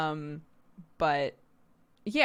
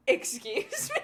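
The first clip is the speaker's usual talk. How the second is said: laughing disbelief; 6 semitones higher than usual. Retold or imitated. Mom's stunned reply, laughing.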